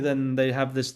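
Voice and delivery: ordinary talk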